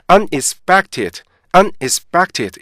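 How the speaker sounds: "unexpected" slowly split, un apart from expected; heavy, stiff reading. Retold or imitated. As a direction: stiff learner's "unexpected" in separate heavy pieces. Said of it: "굉장히 무겁고 딱딱하고".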